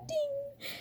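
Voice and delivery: squeaky high, soft ding sound; mock ding. Vlogger mocking CinemaSins reviews squeaks a soft high ding. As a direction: chirp the ding like a tiny bell.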